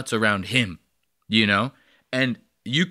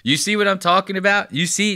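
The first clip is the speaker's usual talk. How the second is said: ordinary delivery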